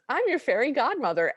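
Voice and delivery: high-pitched mock fairy godmother announcement. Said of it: "And then she says".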